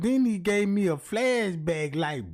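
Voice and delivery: ordinary talk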